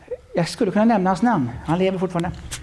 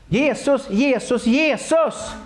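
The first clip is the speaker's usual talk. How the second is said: calling out; 9 semitones higher, slower than usual. Real, not acted. Preacher calls out to congregation.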